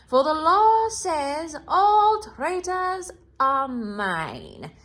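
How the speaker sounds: high, slow, loud witch voice; a menacing witch claiming her prize. Acted. Witch continues demand.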